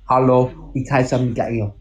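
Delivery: a careful second go at a German accent; improved German accent. Shown as a demonstration. Retrying German for friends, somewhat better German accent. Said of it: "it's a little better".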